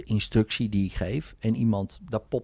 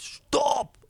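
"Stop!" pitched much higher, a released cry; retold scream. Client finally yells at fighting parents in a much higher cry. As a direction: let "Stop!" burst out high. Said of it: "gaan ze schreeuwen".

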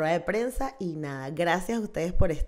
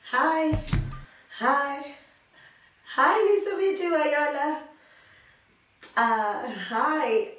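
high, loud excited greeting; starstruck; 9 semitones higher, 4 dB louder, much slower than usual. Real, not acted. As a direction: gush hello like a thrilled fan. Starstruck greeting to Viola Davis.

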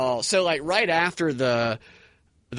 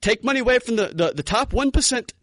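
higher, stump-speech mock Bernie; mocking a canned campaign answer; Bernie Sanders impression; 6 semitones higher, faster than usual. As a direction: rattle off the campaign line, pitched up. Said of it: "his exact verbiage".

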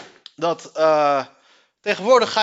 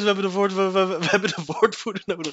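ordinary talk, nothing marked

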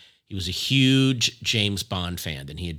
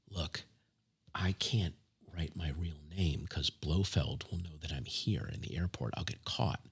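low, soft, near-whispered secret; hushed conspiratorial voice, imitating a spy; confiding secretively; 5 semitones lower, 8 dB quieter than usual. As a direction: let the kid in on a secret, quietly. Retold star confides secret to kid.

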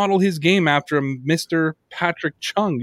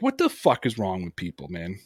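low exasperated mutter; 8 semitones lower, 7 dB quieter than usual. Retold or imitated. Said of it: "I texted my wife and I'm like".

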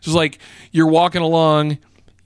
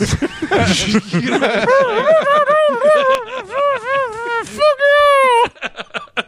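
long high cackle, real; helpless high laughter; high wheezing laugh; 3 dB louder than usual. Real, not acted. Reacting to own joke.

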